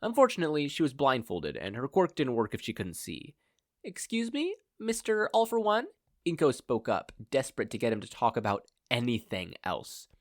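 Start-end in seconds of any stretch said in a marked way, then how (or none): 3.8-5.9 much higher, sweetly polite; polite captive mother. Acted. Blindfolded mother politely interrupts her kidnapper. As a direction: interrupt with mock courtesy.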